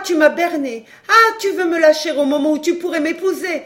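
loud, high-pitched, scornful fury; outraged tirade. A narrator's reading. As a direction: rage at the lover who duped you. Scorned mistress rages at her lover. (tirade furieuse de femme trahie)

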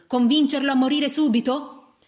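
loud, high indignant question; almost shouting. A narrator's reading. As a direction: cut in, nearly yelling. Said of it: "intervenne la moglie del locandiere quasi gridando".

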